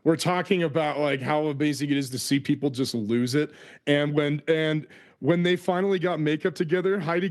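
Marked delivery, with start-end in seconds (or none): none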